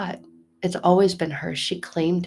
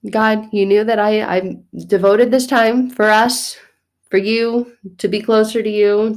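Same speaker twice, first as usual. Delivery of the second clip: loud, raised-pitch blaming rant; mock indignant complaint; 4 semitones higher, 6 dB louder than usual. Interrupted mother blames God for the interruption, indignant. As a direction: scold God in playful exasperation.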